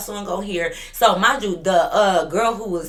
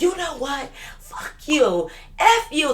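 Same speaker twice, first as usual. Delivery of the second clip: mock drunk girl snapping; 8 semitones higher, slower than usual. Veronica voiced turning on her.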